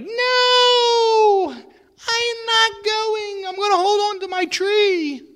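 put-on high, loud crying shout of a clinging child; stubborn refusal. You refusing God, clinging to your tree, crying shout. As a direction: wail it out, refusing to let go of the tree. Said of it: "And you're like".

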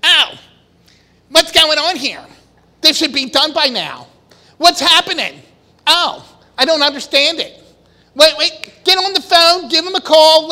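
worked-up, loud, anxious complaints; impatient panic. Retold or imitated. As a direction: act the flustered builder demanding answers. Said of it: "What's our first attitude?".

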